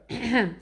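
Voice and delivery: a quick cleared throat before the joke; throat clearing. A narrator's reading. Ruby getting ready to tell a joke, clearing her throat. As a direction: clear your throat to start. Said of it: "Ruby clears her throat".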